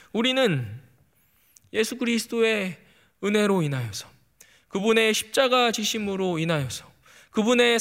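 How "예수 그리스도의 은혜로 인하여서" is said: usual speaking voice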